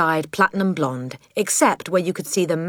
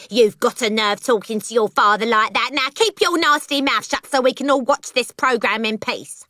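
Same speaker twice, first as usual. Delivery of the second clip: high-pitched cockney nag; scolding mother; 7 semitones higher than usual. Acted. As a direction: tell the child off shrilly.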